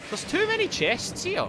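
high-pitched wary worry; nervous suspicion. Real, not acted. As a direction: say it high, sensing a trap. Suspects a trap.

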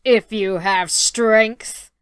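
slow booming giant. Acted. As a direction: speak it like a slow heavy giant. Giant speaks in story.

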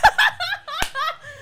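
real, high squealing laughter; high cackling laugh. Real, not acted. Streamer laughing at the [name] joke, high cackle. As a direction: crack up helplessly at your own joke.

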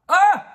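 short, very high, loud yelp; startled scream. A narrator's reading. Mum hugged unexpectedly from behind, startled scream. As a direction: let out a sudden shriek of surprise. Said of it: "krzyknęła mama".

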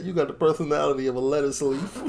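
quoting the insult through laughter; laughing. Real, not acted. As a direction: laugh your way through the line.

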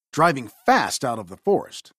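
plain reading voice

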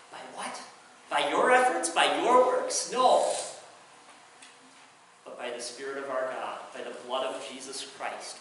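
ordinary talk

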